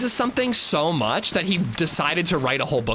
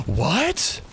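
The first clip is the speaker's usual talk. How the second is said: gasp, then a higher, baffled "what"; mock gasping disbelief; 9 semitones higher than usual. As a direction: imitate someone acting stunned. Acted moviegoer feigning confusion.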